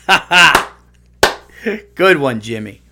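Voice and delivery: loud hollow fake laugh; hammed-up polite laughter from staff; fake staffer laugh. Imitating lone writers laughing in an empty studio. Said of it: "two staffers or two writers like".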